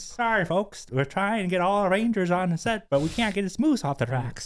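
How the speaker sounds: mock Canadian accent. The speakers announcing a delay in a Canadian accent. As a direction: butcher a Canadian park ranger announcement. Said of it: "in a Canadian accent".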